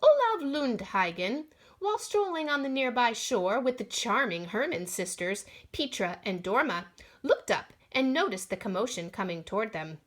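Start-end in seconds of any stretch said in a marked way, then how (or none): none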